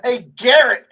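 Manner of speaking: loud high taunting call; mock call out. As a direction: holler his name up high. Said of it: "calling out to Garrett Cole".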